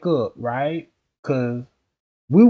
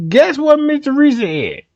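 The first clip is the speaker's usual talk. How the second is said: high, loud teasing hype; hyped up; 9 semitones higher, 7 dB louder than usual. Real, not acted. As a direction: tease the gossip, all hyped. Repeats teaser even louder.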